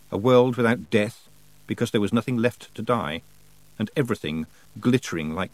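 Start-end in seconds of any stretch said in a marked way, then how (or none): none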